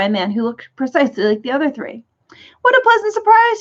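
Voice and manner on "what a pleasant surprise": much higher, louder delighted cry; overjoyed greeting at the door; happy cry. A narrator's reading. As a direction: burst out with joy at a visitor. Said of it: "he cried happily".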